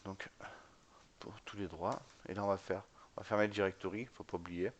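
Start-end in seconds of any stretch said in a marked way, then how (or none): none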